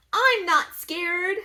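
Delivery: high, slow, brave little voice; a tiny mummy insisting he's brave; little baby mummy. Acted. Baby mummy insists he's not scared, slow and high.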